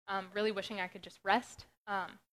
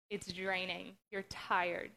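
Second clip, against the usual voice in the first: normal talking voice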